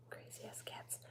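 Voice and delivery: hushed, breathy aside; whispered aside. Real, not acted. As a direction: whisper it to yourself. Muttering about cats.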